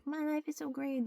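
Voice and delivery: high, sing-song fake cheer; lightly mocking bright brag; mock cheery post. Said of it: "they're like".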